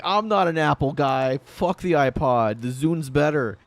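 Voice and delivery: mocking his old loyal Zune self; cocky younger self. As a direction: boast like a smug teenage fanboy. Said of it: "I was like".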